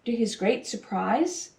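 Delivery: ordinary delivery, nothing marked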